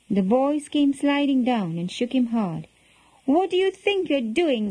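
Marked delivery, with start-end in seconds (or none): 3.3-4.7 mock shouted scolding. A narrator's reading. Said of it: "they shouted".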